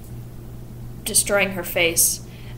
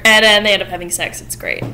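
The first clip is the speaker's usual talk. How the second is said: ordinary talk, nothing marked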